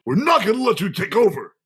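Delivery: a hint of heroic declaration; mock heroic. Co-host voices Cole pointing at the villain, mock heroic.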